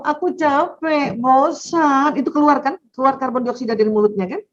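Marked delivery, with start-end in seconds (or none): 0.0-2.2 put-on slow, high, drawn-out child's whine; whiny tired child. Child whining to mother that he is tired and bored. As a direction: sound like a worn-out kid complaining.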